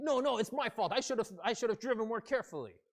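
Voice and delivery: high, loud insistent self-blame; angry counter-apology. Acted. Other driver arguing it was his fault, angrily. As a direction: cut in and fight for the blame. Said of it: "Or even without the angry voice".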